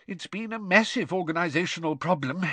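mildly exasperated sighing voice; sighed. A narrator's reading. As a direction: sigh it out like a harried organiser. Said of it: "sighed Mister Weasley".

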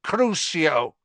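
high-pitched cold incantation. A narrator's reading. Master curses his servant, high and cold. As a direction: cast the curse with a touch of cold force. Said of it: "said the cold voice".

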